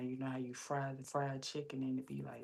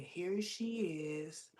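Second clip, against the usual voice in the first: higher, slow mimicked greeting; drawn-out greeting; 4 semitones higher, much slower than usual. Pantry lady greeting her in line, drawn-out. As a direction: mimic a greeter stretching the words.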